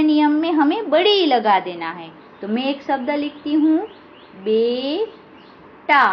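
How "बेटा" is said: slow dictation. Shown as a demonstration. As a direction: stretch out बेटा very slowly. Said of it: "आ की मात्रा आई". (बहुत धीरे खींचकर बोलना)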